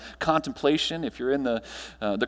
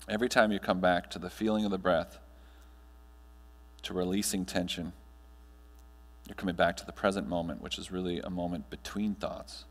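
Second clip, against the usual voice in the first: guided meditation; 3 semitones lower than usual. Acted. Speaker guiding the audience through breathing, calm meditation voice. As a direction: ease the room gently into stillness.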